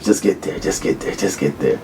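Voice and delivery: repeated self-talk fading to a whisper; urging himself on. Retold or imitated. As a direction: quietly chant it to yourself, dropping away. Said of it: "I was just telling myself".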